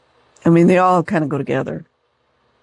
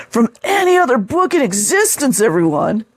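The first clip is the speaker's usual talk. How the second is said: higher, hammed-up gushing; over-the-top mock; 5 semitones higher than usual. Shown as a demonstration. Mocking the reviewer's gushing, hammed up higher. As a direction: ham it up like a true believer. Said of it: "See how they go over the top".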